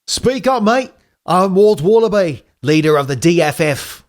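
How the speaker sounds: Walt Wallaby. Acted. Wallaby leader introducing himself to Tails, high and bold. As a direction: introduce yourself like a proud wallaby chief. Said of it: "So back to Walt Wallaby".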